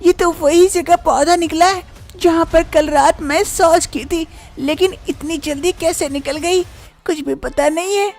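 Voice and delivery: bahu wondering then denying, pitched high; high young voice, first hushed then insistent. Acted. Bahu denies planting the tree to her mother-in-law, high voice.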